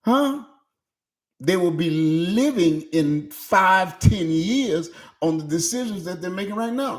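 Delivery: normal talking voice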